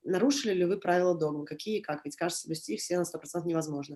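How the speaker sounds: flat, fast, quiet recitation with no intonation; flat text reading. Shown as a demonstration. Actor reading his script flat to show the method, flat fast read. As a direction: rattle off the text with no intonation at all. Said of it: "like this".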